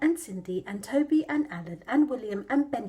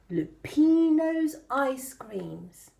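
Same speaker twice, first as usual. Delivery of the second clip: slow announcement; much slower than usual. Acted. Reading van sign slowly.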